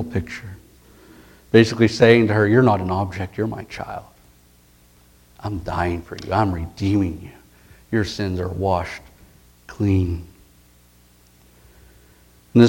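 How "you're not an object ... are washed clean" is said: tender. Retold or imitated. Jesus speaking tenderly to woman.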